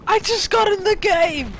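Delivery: high, slow, loud cry of joy; excited shout. Real, not acted. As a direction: yell it out thrilled. Gamer excited on spawning.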